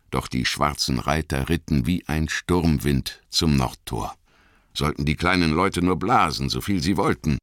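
ordinary delivery, nothing marked

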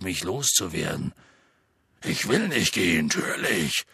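aggrieved big-man voice, mostly low; Hagrid. Acted. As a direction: grumble through the complaint deep down, livelier at the end. (tiefe, gekränkte Hagrid-Stimme)

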